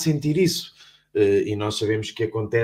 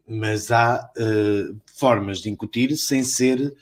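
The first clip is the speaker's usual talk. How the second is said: usual speaking voice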